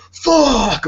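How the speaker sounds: high, loud mock yell; imitated yell. Telling friend about neighbor yelling one morning, loud imitated yell. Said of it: "this guy just yelling".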